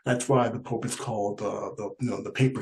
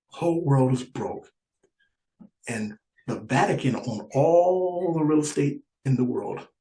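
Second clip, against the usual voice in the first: ordinary talk, nothing marked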